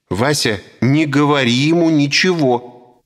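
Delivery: high, stern, commanding wife; stern woman's order. A narrator's reading. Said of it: "строго приказала женщина". (строгий высокий женский приказ)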